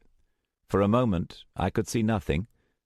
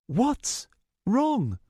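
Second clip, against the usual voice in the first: higher, slow puzzled voice; puzzled young woman. Acted. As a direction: ask in puzzlement. Luna asks Starbreeze.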